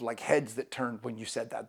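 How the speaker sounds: ordinary delivery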